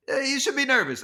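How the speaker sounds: high-pitched teasing impression; Larry. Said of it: "And he goes".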